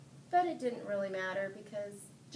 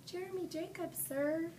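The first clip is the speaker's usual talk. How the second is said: put-on polite boy answer; 7 semitones higher than usual. A narrator's reading. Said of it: "I told him".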